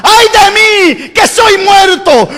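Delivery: high, slow, drawn-out lament; high slow prophet's wail; prophet's anguished cry. Acted. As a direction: cry it out in dread before God. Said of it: "dice el profeta".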